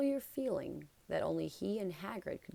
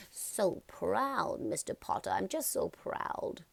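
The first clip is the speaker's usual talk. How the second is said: emotional admirer; 2 semitones higher, slower than usual. A narrator's reading. Fan overwhelmed, breathy.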